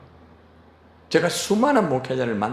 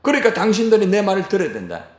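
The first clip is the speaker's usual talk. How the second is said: higher and louder bossy demand, slowing at first and fast at the end; pompous authoritarian lecture; domineering father; 7 semitones higher, 4 dB louder than usual. Retold or imitated. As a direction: lay down the law to the Corinthians. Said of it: "이게 아닙니다".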